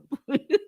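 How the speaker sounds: real laugh with a rising lilt; cracking up at the memory. Real, not acted. Recounting the customs flirt to viewers, laughing.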